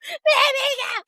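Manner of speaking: put-on high, drawn-out kid's tantrum cry; wailing child. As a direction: imitate a crying kid shrieking to be put down. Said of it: "when kids are already crying".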